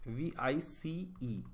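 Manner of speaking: letters spelt one at a time, slowly; slow spelling. Shown as a demonstration. Teacher spells service slowly to test the answer. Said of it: "service बन सकता है क्या?".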